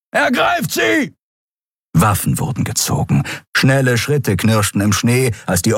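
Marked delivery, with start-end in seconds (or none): 0.1-1.1 high, far-off inhuman shout; nonhuman call. Acted. Alien guard spotting the fugitives, inhuman shout. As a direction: cry out in an alien voice. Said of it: "rief eine nichtmenschliche Stimme".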